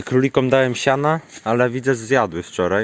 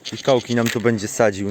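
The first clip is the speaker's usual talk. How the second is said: normal talking voice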